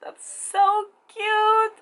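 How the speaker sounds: high, slow drawn-out gush; gushing squeal. Retold or imitated. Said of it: "I was like".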